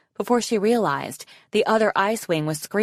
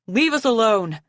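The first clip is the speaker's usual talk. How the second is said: raised, desperate shout; 5 semitones higher, 4 dB louder than usual. A narrator's reading. Arctic warning off the pursuing guards, shouting. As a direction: shout them off, pitched up. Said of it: "Arctic shouted at the guards".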